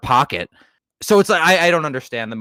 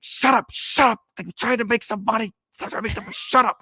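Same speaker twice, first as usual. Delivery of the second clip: much higher, frantic put-on voice of a flustered showman; George Costanza-esque whine; 11 semitones higher than usual. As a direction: squeal like a panicked hustler shushing a customer. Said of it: "Very George Costanza-esque".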